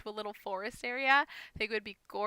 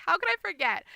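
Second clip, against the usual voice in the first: mock panic, pitched up; retold freak-out; 7 semitones higher, 4 dB louder than usual. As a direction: replay a little freak-out at higher pitch. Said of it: "I was, like, freaking out".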